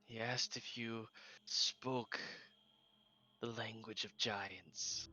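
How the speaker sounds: doctor relaying the question; doctor character. Acted. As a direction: translate it slowly and calmly. Doctor translates.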